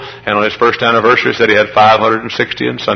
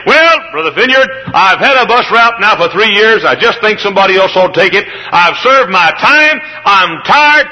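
higher, louder mock grumble; tired bus worker; 9 semitones higher, 3 dB louder than usual. Bus worker to Brother Vineyard, loud mock grumbling. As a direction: whine like a worker quitting his route. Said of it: "The sin is when you say".

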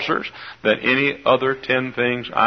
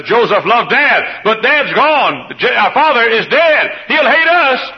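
rising high and louder in alarm, slow at first; panicked brothers; 9 semitones higher, 5 dB louder, slower than usual. Acted. Preacher voicing frightened brothers after their father's death: rising panic. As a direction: build fear until it breaks high.

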